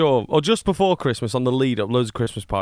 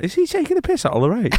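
mock-outraged viewer, high-pitched with laughter; high, incredulous imitation of a baffled viewer; puzzled viewer; 13 semitones higher, faster than usual. Singer voices viewers baffled by his switched accent, mock-incredulous. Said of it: "people who didn't know me must have been like".

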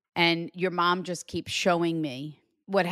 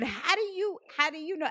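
high amazed dad; lightly voiced astonished father; 9 semitones higher than usual. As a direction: ask in wonder, pitched up. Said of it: "And he's going".

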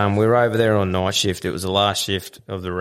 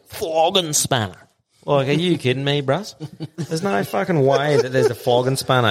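slowed, higher incredulous mock outrage; retold disbelief; 5 semitones higher than usual. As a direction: replay your stunned reaction to the apprentice prank. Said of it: "And I'm thinking".

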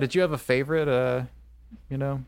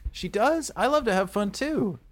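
mock bubbly contestant; 10 semitones higher than usual. Mocking contestant's bubbly line.

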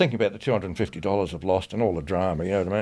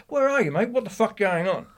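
high-pitched agitated phone rant; retold upset demand; 14 semitones higher than usual. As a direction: demand answers, worked up and high. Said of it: "you sounded really upset".

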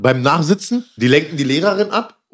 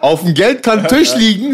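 broken German; 6 semitones higher than usual. Retold or imitated. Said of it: "mit gebrochenem Deutsch".

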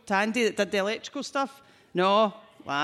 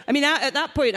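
usual speaking voice

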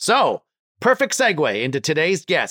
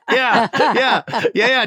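laughing; 8 semitones higher, 4 dB louder, slower than usual. Real, not acted. Host laughing at accent teasing.

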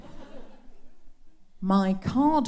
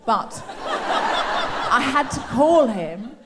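laughing; 4 semitones higher, 3 dB louder, much slower than usual. Real, not acted. Laughing drawn-out line.

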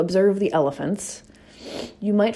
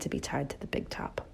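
whisper; 3 semitones lower, 11 dB quieter than usual. Acted. Emphatic closing point.

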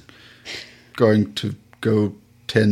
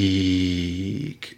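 troll voice; 2 semitones lower, 5 dB quieter than usual. Acted. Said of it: "And he goes".

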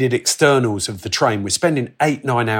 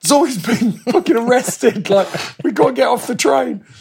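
panicked; 12 semitones higher than usual. Retold or imitated. Telling the producer Zoid's been arrested, in frantic laughing panic. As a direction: blurt it out in a frantic rush.

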